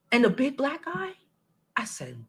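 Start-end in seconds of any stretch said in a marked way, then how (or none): 1.8-2.3 whisper. Real, not acted. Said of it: "unvoiced whisper".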